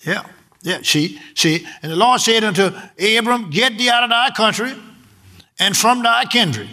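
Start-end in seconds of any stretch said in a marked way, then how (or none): 1.8-6.7 raised, solemn scripture reading; booming biblical narration. Acted. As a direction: proclaim it like King James. Reads Genesis aloud, higher.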